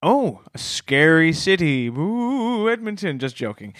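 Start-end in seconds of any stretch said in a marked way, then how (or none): 1.9-3.2 high, drawn-out spooky tease; mock spooky ooh. Cohost jokingly calls Edmonton a scary city, mock spooky. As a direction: make the city name sound scary, jokingly.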